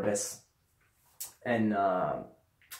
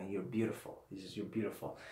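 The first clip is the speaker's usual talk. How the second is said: much softer, trailing mumble; quiet dazed mumble; mumbling softly; 3 semitones lower, 14 dB quieter than usual. Retold or imitated. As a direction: mumble it half to yourself. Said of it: "I don't know what I'm mumbling".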